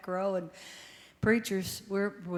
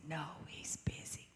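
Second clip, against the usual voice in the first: whisper; 2 semitones lower, 11 dB quieter than usual. Retold or imitated. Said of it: "he whispered when he answered".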